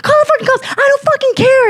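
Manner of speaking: high-pitched, reckless angry yell; retold screaming. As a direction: re-enact screaming at the store man. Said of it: "I just started screaming".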